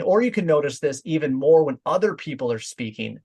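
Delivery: ordinary delivery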